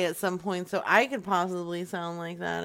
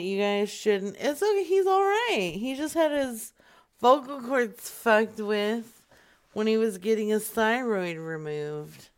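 ordinary talk, nothing marked

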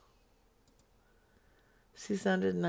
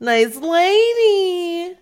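high slow sing-song; 11 semitones higher, 13 dB louder, much slower than usual. Pretend. Mocking repeat of contact name.

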